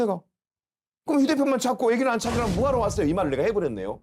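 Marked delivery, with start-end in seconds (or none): 1.1-3.1 higher-pitched retold reproach; retold indignant challenge. Rescuer confronting fire chief over missing child, indignant retelling. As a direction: challenge them, mildly heated.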